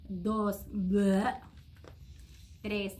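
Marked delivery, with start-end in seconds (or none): 0.7-1.3 loud gagging bleh of disgust. A narrator's reading. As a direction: spit out a yucky taste. Child eating disliked candy.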